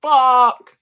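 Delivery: slow, high stunned disbelief; Sanji stunned. Retold or imitated. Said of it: "That's what Sanji said".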